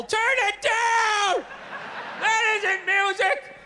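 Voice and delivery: hammed-up yell at the noisy neighbours upstairs; grumpy old man yelling. Lonely old man screaming up at the young couple, slow mock yell. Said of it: "screaming up at the younger couple".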